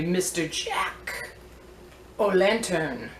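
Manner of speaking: slow, stretched mock Irish lilt; attempted Irish accent. Vlogger presents a jack-o'-lantern toy, attempted Irish accent. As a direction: have a poor go at an Irish accent. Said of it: "I wish I could do a good Irish accent".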